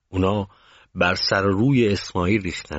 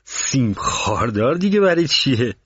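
puzzled, higher-pitched question; surprised question; 6 semitones higher than usual. A narrator's reading. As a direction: ask it in raised-pitch surprise. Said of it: "با تعجب پرسید".

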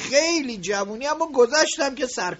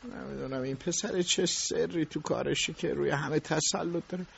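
much softer, lower, private thought; musing to himself; 8 semitones lower, 9 dB quieter than usual. Acted. Boss alone wondering about the boy, muttering. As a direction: think aloud, alone.

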